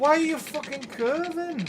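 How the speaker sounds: loud, high frustrated question; exasperated. Real, not acted. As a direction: demand an answer from the game. Annoyed at the game's track.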